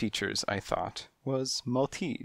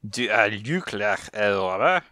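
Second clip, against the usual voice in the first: put-on French accent; 5 dB louder than usual. French cat voiced.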